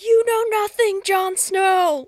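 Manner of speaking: very loud, high mock-dramatic yell; hammed-up furious shout. Recapper acting out Henry flipping the chess table, hammed-up shout. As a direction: bellow it like an overblown movie meltdown.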